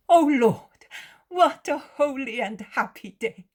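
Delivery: high, loud burst of joy; overjoyed exclamation. A narrator's reading. Woman welcomes her Lord, overjoyed. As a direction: cry out joyfully, pitched high.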